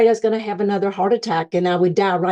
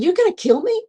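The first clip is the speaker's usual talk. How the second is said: high, rising mock-alarmed question; incredulous question; 9 semitones higher, much faster than usual. Patient questioning the nurses' treadmill plan, incredulous. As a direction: squeak it upward in disbelief.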